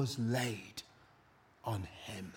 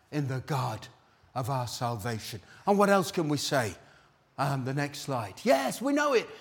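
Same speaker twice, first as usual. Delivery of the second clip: ordinary delivery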